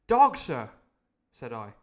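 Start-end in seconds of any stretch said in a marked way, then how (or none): 0.1-0.7 loud answer. Acted. Jim urgently answering Silver.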